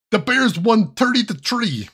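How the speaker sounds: slow, high mock Chicago fan; silly Chicago accent, TH as T. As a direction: exaggerate it like a Chicago Bears fan. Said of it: "you're gonna hear the Chicago accent".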